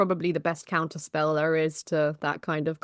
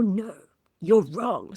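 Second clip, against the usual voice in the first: lightly acted scolding parent, louder on the end; parental scolding; 4 semitones higher, 5 dB louder, slower than usual. Analyst showing a parental retort to him, mildly scolding. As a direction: talk down with a touch of reprimand. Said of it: "retaliate with your own parental".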